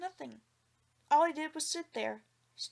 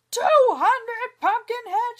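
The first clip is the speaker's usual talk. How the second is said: high, loud, slow shriek; furious shriek, much higher and louder; 11 semitones higher, 12 dB louder, slower than usual. A narrator's reading. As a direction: shriek it with rage. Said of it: "shrieked Li Tong".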